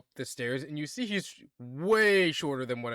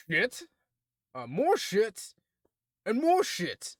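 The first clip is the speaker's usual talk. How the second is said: higher, louder British complaint; grumbling British dwarf; 8 semitones higher, 4 dB louder than usual. Acted. Dwarf blacksmith complaining to the party, British grumble. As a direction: moan about the workload in a British accent.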